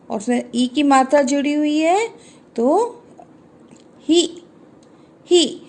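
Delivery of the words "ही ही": ही said short and quick. Shown as a demonstration. Teacher models ही for children, short i. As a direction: clip ही with a short i. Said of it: "छोटे वाला, short sound वाला ई".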